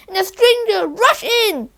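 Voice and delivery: ordinary delivery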